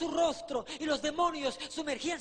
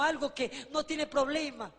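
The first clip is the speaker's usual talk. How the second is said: ordinary talk, nothing marked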